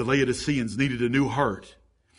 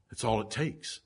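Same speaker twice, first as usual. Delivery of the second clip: ordinary delivery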